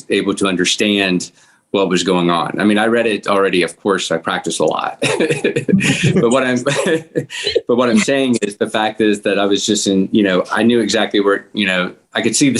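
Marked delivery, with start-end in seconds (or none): 5.1-6.1 laugh. Real, not acted. Laughs at himself mid-answer. 6.7-7.6 laugh. Real, not acted. Laughs again, cutting own sentence.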